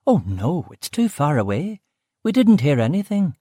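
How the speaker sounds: brusque West Belfast cook. Acted. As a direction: Belfast woman, short with the visitor. Aileen answers.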